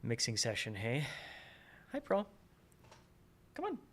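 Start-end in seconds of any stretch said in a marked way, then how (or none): 1.9-2.3 Hi, Pearl in a squeaky high coo; high pet voice. Real, not acted. Greeting the pet. 3.5-3.8 high sing-song pet greeting. Real, not acted.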